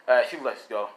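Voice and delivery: ordinary delivery, nothing marked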